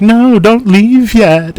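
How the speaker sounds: high, loud, slow begging voice; pleading guests. Retold or imitated. People at the dog party begging him to stay, high pleading. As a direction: whine it high like a clingy guest.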